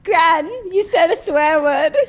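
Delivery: slow, loud, sing-song tattle; a mock child telling on Gran; childish tattling. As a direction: tattle like a little kid. Teasing grandmother, childish.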